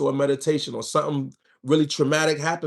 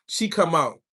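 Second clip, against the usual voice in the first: usual speaking voice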